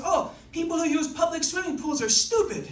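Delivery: put-on dismissive French Canadian accent. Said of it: "like, French Canadian accent".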